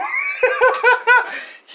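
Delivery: loud high cackle; laughter. Real, not acted. Vlogger relishing the Golden Globes speech, loud high laughter.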